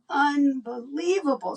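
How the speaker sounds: ordinary delivery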